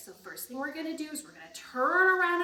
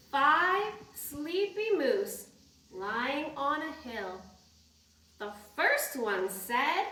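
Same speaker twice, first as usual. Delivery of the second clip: slow, sing-song rhyme reading; reading to children; 3 semitones higher, 4 dB louder, slower than usual. Acted. Teacher reading a bedtime rhyme to kids, sing-song. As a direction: read it slowly like a bedtime rhyme.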